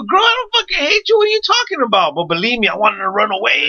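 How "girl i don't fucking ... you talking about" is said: mock high reply. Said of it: "I go".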